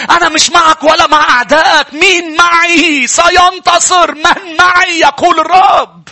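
the commander of the Lord's army rebuking Joshua in a fervent shout; fervent preaching shout. Acted. Preacher voices the Lord's captain.

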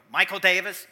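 loud, stern full-name call; mom calling full name. Retold or imitated. Mom calling her son in trouble, loud stern call. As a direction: call him out like he's in trouble. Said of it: "like when my mom would do that".

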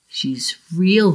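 usual speaking voice

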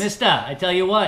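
loud Bostonian, R's dropped; Boston accent. Acted. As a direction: open a yarn like a Boston local. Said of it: "Drop the Oz".

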